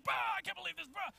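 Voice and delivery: high, blustering loudmouth caricature; bad cop. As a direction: bluster noisily like a mock outraged uncle. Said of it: "he's like the bad cop in this situation".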